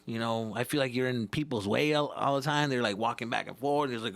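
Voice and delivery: ordinary talk, nothing marked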